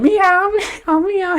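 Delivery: very high squeaky meows; cat meows. Retold or imitated. Retold Catwoman bit, very high meowing. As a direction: meow like a cartoon cat. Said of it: "I'm a Catwoman".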